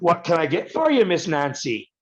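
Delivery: high, mocking jab from the bartender. Irish bartender mocking the American: sneering high taunt. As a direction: sneer it like a teasing barman. Bartender mocks him again.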